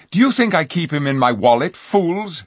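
loud, fast scornful retort; scornful angry king. A narrator's reading. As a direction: scold the fools. King scolds.

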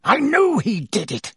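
put-on teacher rants about the boy; shrieking accusation. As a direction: insist wildly.

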